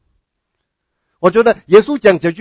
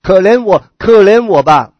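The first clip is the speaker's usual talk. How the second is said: louder, pleading call for mercy; retold desperate plea, voice raised; crying out in appeal; 6 dB louder than usual. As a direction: call out loudly, begging for pity. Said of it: "向耶稣来呼喊".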